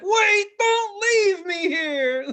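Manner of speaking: very high, very slow, whining laugh-cry; mock desperate plea. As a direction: beg them to stay, stretched and teary. Abandoned tripper begging friends.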